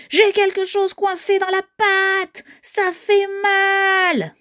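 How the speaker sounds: high, drawn-out monster wail; howling in pain. Acted. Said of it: "hurla Titi de plus belle". (hurlement de douleur aigu et traînant)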